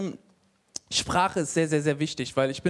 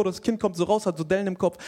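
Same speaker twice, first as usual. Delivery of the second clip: ordinary talk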